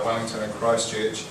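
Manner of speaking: ordinary talk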